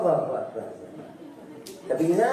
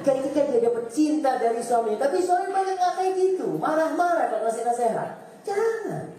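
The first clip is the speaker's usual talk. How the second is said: high, ringing sermon peak; full-force mosque preacher; impassioned preaching; 12 semitones higher than usual. Acted. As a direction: preach it out with rising fire. Fiery preaching stretch.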